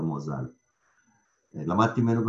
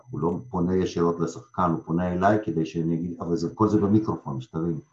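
usual speaking voice

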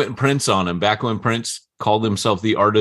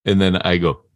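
ordinary talk

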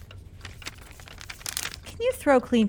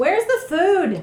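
calling out; 9 semitones higher, 7 dB louder than usual. Acted. Calling out from another room.

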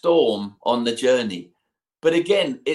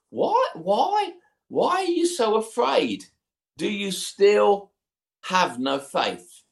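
exasperated rebuke; 6 semitones higher, slower than usual. Acted. Jesus questions the frightened disciples.

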